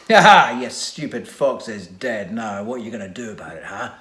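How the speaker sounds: loud laugh then fast taunting; mock him, cackling; gloating laugh. A narrator's reading. Villain gloating over the dead fox, laughing. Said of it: "Dongbrain's turn to laugh".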